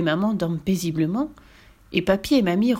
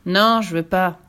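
put-on refusing child; 5 dB louder, slower than usual. Boy refuses soup.

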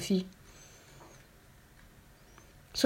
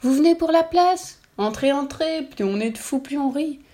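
mad cow; 7 semitones higher than usual. A narrator's reading. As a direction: greet her like a giddy lunatic. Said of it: "orchestre des vaches folles".

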